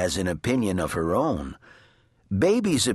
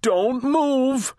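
high, urgent shouted command; alarmed shout; 4 dB louder than usual. A narrator's reading. Mister Watson warning family on a falling bed, shouting. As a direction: shout it high to freeze everyone. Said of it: "shouted Mister Watson".

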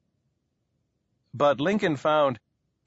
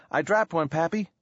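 put-on slightly higher voice for a boasting boy; 5 semitones higher than usual. A narrator's reading. Small boy to his pappy while planting, lightly boasting. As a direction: lightly voice a boy proud of himself. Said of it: "the boy boasted".

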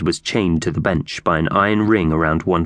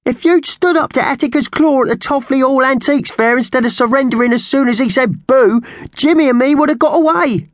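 very high, loud squawking accusation; angry magpie rant; 5 dB louder than usual. Acted. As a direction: squawk the blame at full pitch. Slasher blames Thug in quarrel.

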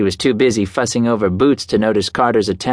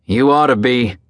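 mean; 2 semitones higher than usual. A narrator's reading. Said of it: "the meanness in his voice".

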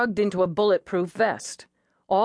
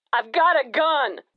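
higher, louder warning about the gun; defiant jumper; 8 semitones higher, 4 dB louder than usual. Acted. Man on the roof warns the negotiator, defiant. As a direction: warn her off, edgy.

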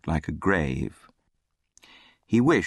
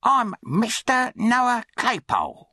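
high, slow, drawn-out announcement; self-important charity boy; slower than usual. A narrator's reading. Charity boy introduces himself to the orphan, high self-important voice. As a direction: stretch out your own name grandly, pitched high.

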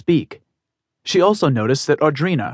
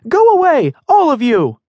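even higher, full-force yell; louder furious shout; 7 dB louder than usual. Acted. Emmy repeats her order to the crowd, louder furious shout. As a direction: shout them all down, angrier. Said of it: "she said".

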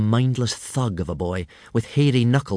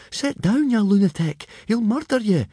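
much higher, alarmed urging; anxious friend; 12 semitones higher than usual. A narrator's reading. Fishlegs urges Hiccup to sit down, much higher and alarmed. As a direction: pull him back down.

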